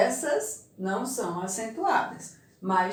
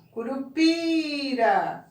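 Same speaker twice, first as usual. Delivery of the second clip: Curupira slowly, with a high, drawn-out PI; stress on PI; 4 semitones higher, slower than usual. Shown as a demonstration. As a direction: hold the PI in Curupira. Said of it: "Qual é a mais forte?".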